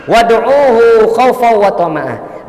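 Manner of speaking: slow, loud Quran recitation; emphatic verse recitation. Real, not acted. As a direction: proclaim the verse, drawing it out.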